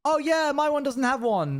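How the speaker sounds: sing-song fake excuse in a high voice; mock excuse. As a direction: play a fan making a lame excuse. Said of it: "You can't just be like".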